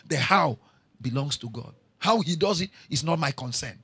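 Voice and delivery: usual speaking voice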